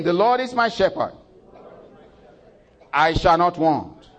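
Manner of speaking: high, loud and slow recital; repeated with congregation. Acted. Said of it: "Say it again".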